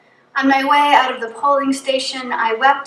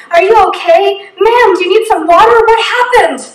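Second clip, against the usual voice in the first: higher and louder, anxious voices of helpers; worried bystanders; 8 semitones higher, 9 dB louder than usual. Retold or imitated. Strangers rushing up to a crying woman, higher and louder in alarm. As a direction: call out to her in alarm, voicing the strangers.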